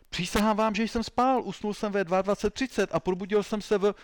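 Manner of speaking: high, loud pleading of innocence; desperate protest. Acted. Suspect swears he was asleep.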